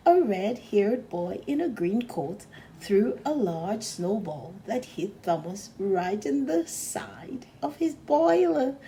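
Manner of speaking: usual speaking voice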